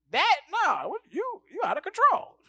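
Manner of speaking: ordinary delivery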